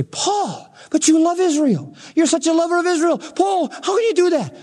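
high, pleading protest; mock the shocked listener, voice up high; pleading objector. Imagined listener protesting to Paul, high and pleading.

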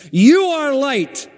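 "You" high, loud and slow; emphatic declaration. Real, not acted. Preacher proclaiming to the church, high and slow. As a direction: proclaim it slowly.

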